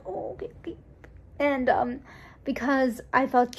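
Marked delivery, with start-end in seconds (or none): 0.1-0.8 breathy whispered okay; whispered retreat. Retold or imitated. People retreating after she says no, whispered. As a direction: back off in a whisper.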